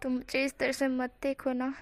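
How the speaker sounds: softly begging him not to stare; shy plea. A narrator's reading. Bashful groom asks Chang to stop staring, softly. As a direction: hush it, flustered and bashful. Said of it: "शर्म से पानी पानी होते हुए कहते".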